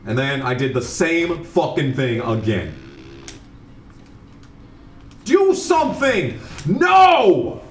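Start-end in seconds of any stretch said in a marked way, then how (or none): 5.2-7.6 screaming in high-pitched rage; furious shout. Real, not acted. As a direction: yell at the screen in fury. Raging at the game.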